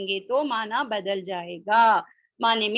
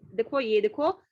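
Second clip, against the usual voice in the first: ordinary talk, nothing marked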